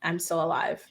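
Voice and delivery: imitating a flat, joyless statement; subdued, empty quote. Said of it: "it was just, like, flat".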